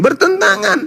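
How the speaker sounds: very high, held answer; emphatic self-answer. Real, not acted. Debater answers his question about Sunday worship, very high emphatic answer. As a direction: send the answer soaring and hold it.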